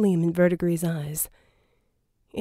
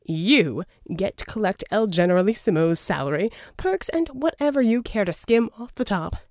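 Verdigris voiced. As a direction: sell the deal, oily and grand. Verdigris pitching a deal.

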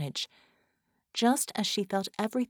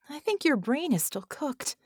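high-pitched, worried doubt; worried, lifted voice; concerned; 7 semitones higher than usual. A narrator's reading. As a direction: say it with anxious concern. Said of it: "she said with concern".

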